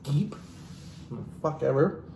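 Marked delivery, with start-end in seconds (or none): 0.0-0.3 "Jeep" whispered with a small rise. Shown as a demonstration. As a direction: breathe "Jeep" without voice. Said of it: "repeat said as a whisper".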